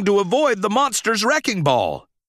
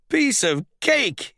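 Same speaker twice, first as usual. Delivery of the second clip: cocky aside; 7 semitones higher, slower than usual. Acted. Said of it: "he said to himself".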